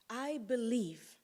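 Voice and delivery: ordinary delivery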